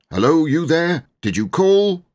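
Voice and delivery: loud, high call across the yard; shout. Acted. Servant Andrew shouting up to the church. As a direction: call out to the tower. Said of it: "Andrew shouted".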